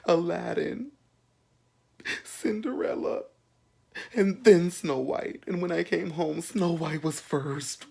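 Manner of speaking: high, slow whiny complaint; mock the upset Disney fan in a high voice; prissy high-pitched tattletale voice; mimicking the roommate. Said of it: "He's like".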